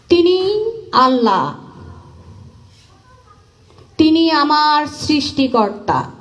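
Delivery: measured verse with lifted, sustained phrases; devotional poem recitation. Acted. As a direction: recite as a declaration of faith, pausing after each phrase. Recites own religious poem. (ভক্তিভরে থেমে থেমে কবিতা আবৃত্তি)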